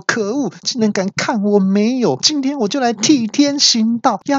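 high, rising outraged cry; indignant yell. Retold or imitated. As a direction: play the outraged hero. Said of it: "我听到之后就大叫说".

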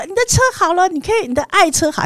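high-pitched mock caller voice on the phone; imitating the cheerful shop clerk; repair shop caller. Mechanic phoning the narrator that the car is ready, high and cheery. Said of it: "就打电话说".

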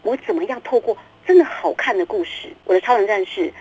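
usual speaking voice

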